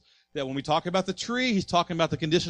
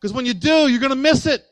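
loud, higher pulpit preaching; fiery preaching; 4 semitones higher, 8 dB louder than usual. Acted. Pastor urging the congregation not to harden hearts, loud preaching.

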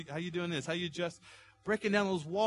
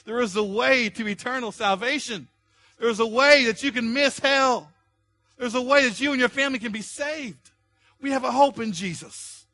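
preaching at full force; 8 semitones higher, 7 dB louder than usual. Acted. Pastor urging the congregation, preaching at full force. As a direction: preach it hard to the room.

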